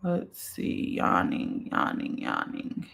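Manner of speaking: breathy whispered muttering under her breath; whispering to herself. Real, not acted. Searching through viewer comments, muttering to herself in a whisper. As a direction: murmur it to yourself while scanning the page.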